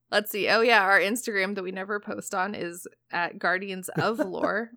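ordinary delivery

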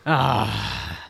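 low, mock-ominous villain; mock evil voice. Shown as a demonstration. Co-host joining in to parody the dream villain, low evil voice. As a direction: drop deep for a stock mysterious dream villain. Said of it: "It's exactly that type of shit".

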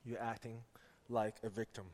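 a quiet word from God; voice of the Lord. Retold or imitated. The Lord tells the father, softly. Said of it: "the Lord spoke to me".

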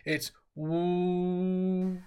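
long steady woo like a foghorn. Shown as a demonstration. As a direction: hold a droning foghorn woo. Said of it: "like a foghorn".

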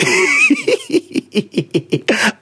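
helpless high cackle; high-pitched real laughing fit. Real, not acted. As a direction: laugh hard in a high, staccato run. Cracks up at loud farts.

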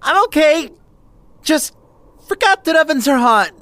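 pained. Acted. Just burned arm on oven.

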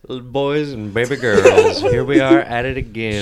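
loud, slow showman intro with laughter; announcer voice. Acted. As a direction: boom it out to the crowd. Host continues show intro.

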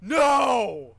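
loud, high, drawn-out mock scream; re-enacted scream. Fan re-enacting screaming at the TV, anguished mock scream. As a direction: scream it long, falling away. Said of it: "I, I just scream".

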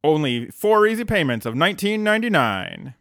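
upbeat infomercial pitch; hard sell ad. Pretend. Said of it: "we have an idea for merch now".

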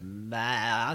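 cut-off robot voice attempt. Shown as a demonstration. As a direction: start a robot voice, then stop. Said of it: "Maybe I'll say it like the robot".

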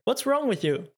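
high, put-on character voice; بصوت تمثيلي. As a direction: read it as a high-pitched game character. Reading a game line aloud.

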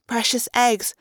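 ordinary delivery

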